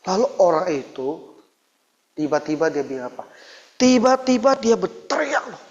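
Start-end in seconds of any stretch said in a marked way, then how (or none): 3.8-5.6 rising alarm as he tells it; excited outburst. Real, not acted. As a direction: voice jumps up in alarm. Preacher exclaims the scream. (seruan kaget yang tinggi)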